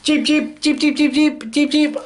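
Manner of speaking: tiny, very high cheeps; chirping baby birds. Acted. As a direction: peep rapidly like newly hatched chicks. Voices hatched baby birds.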